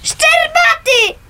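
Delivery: high, loud dawning fear; frightened outburst; alarmed realisation. Acted. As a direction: realise who burned it. Girl realises who did it.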